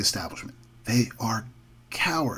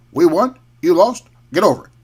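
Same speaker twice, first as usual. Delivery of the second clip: louder, blunt retold victory line; quoting Obama; 3 semitones higher, 5 dB louder, faster than usual. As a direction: imitate a winner laying down the law. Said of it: "he says, quote".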